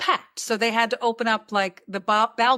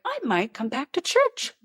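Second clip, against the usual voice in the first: mock sincere resolve; 5 semitones higher than usual. Sarcastic quote of own thought.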